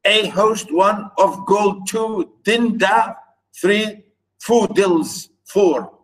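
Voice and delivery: "a HOST, one, of GOLD, two", foot by foot; "en-DA, three, ffo-DILS, four", each foot set apart; counting metrical feet. Shown as a demonstration. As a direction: chop "a host of golden daffodils" into counted syllable pairs. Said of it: "I'm exaggerating maybe a little bit".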